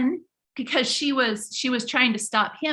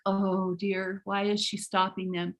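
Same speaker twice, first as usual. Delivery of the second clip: low, soft worried muttering; a hint of timid kids; 5 semitones lower, 5 dB quieter than usual. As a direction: voice the fretting children softly. Kids voiced behind the lady.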